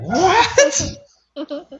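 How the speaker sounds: very high, laughing "what?"; stunned disbelief, breaking into a laugh; surprised. Retold or imitated. Stunned reply to Spanish answer.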